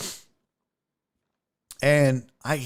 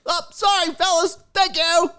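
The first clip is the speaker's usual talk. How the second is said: put-on high, chipper old man voice; creepy dad voice. Dad apologizing to the guys, high chipper voice. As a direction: play the oblivious cheerful dad. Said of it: "the dad's like".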